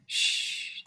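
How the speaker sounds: soft breathy shush; hushing. A narrator's reading. Travelers listening for a voice, soft shush. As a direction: hush everyone to listen.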